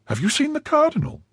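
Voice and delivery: high, startled wife's cry; a wife alarmed at her husband's news. A narrator's reading. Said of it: "cried Madame Bonacieux".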